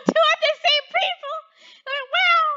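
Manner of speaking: high, loud, hammed-up astonishment; mock a player who is utterly amazed; imagined amazement. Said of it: "He's like".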